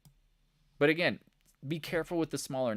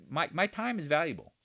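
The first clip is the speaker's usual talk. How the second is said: ordinary delivery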